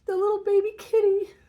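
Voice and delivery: crying through the words; real crying. Real, not acted. Telling viewers about the kitten, breaking into real tears. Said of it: "God, I'm gonna cry".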